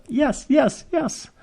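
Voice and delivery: high, celebratory yeses; triumphant cheer. Retold or imitated. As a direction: cheer each yes like a win. Retold cheer at best pictures ever.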